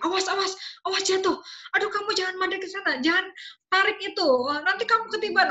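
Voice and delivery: put-on high, rushed, fussing parent scolding a toddler; panicky parent warning. Anxious parent rapidly warning toddler away from danger, high and hurried. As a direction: fire off warnings like a panicky mom. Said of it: "orang tuanya mungkin lebih banyak bilang tidak, jangan".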